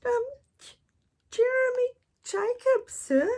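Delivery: put-on very high, halting little-boy reply; timid boy. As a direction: stammer your name nervously to the pirate. Timid boy answers pirate.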